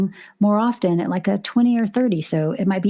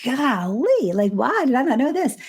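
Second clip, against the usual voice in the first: higher-pitched imitation of amazed women; amazed discovery; 5 semitones higher than usual. Teacher voicing women who just learned something new, amazed. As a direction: mimic someone's wide-eyed realization, drawn-out first word.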